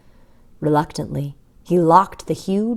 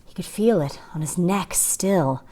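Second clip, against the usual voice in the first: plain reading voice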